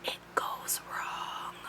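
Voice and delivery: hushed ominous whisper; whispered, deadpan doom; dramatic whisper. Real, not acted. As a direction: drop to a spooky whisper for the punchline. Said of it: "dramatic whispered aside".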